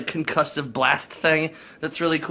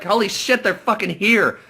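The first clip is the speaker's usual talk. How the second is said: higher, louder panicked outburst as the invaders land; panicked exclamation; 7 semitones higher, 4 dB louder than usual. Retold or imitated. Bystanders realize the invaders have arrived, panicked higher louder cry. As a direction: blurt it out like people caught off guard by an attack.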